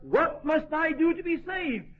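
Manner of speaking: high, drawn-out retold plea; crying out. Preacher voices the jailer at Philippi, crying out. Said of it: "he cried out".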